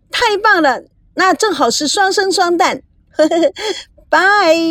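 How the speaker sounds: high, giggly excitement; delighted. Acted. Girl ends a phone call with great news, delighted. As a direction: gush happily and sign off on a high bright "bye".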